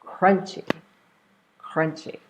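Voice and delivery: CRUNCHY with the weight on CRUN; crunchy, strong start and light ending; first-syllable stress. Shown as a demonstration. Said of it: "we're stressing the first one".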